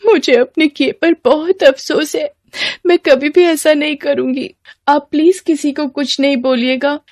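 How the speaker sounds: high, pleading apology; remorseful schoolgirl. Acted. Girl promising teacher she will never repeat it, remorseful. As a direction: apologise and beg for secrecy.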